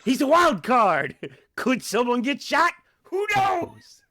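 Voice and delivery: high, manic excited exclaiming; wild hype. Acted. Podcaster selling a dangerous wild card, manic hype. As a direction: crank it up way high, gleefully reckless. Hyped-up joke about danger.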